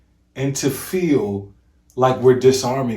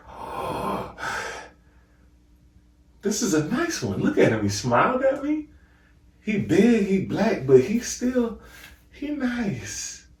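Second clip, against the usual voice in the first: mock relieved listener; 8 semitones higher than usual. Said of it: "You immediately feel like".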